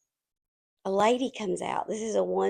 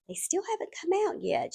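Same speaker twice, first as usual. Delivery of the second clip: whisper; 10 semitones higher, 5 dB quieter than usual. Retold or imitated. Receptionist quietly reports to teller.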